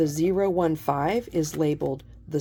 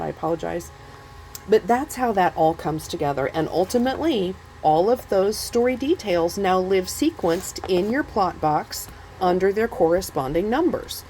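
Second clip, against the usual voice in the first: ordinary delivery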